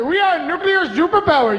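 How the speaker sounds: put-on proud singsong Indian accent. Nation boasting at the UN, in a put-on Indian accent. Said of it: "that Indian accent".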